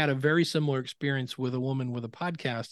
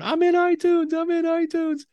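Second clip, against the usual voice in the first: high, loud overjoyed scream into the phone; excited scream; 5 dB louder than usual. Retold or imitated. Podcaster screaming to her coach on the phone, excited shriek. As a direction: shriek it with disbelieving joy. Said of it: "her screaming in the phone".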